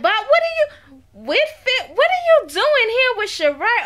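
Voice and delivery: high, swooping, excited surprise; mock astonished greeting. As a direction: imitate her squealed surprise at seeing him.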